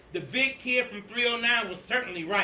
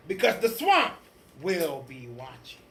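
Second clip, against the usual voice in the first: menacing witch teacher; 4 semitones lower, 4 dB louder than usual. Acted. Witch threatens class.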